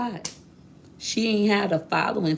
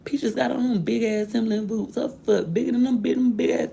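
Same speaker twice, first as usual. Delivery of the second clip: high, rising taunting cousin voice; hammed-up cousin jeer; mocking cousin; 7 semitones higher than usual. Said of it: "he say".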